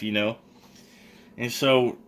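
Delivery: ordinary talk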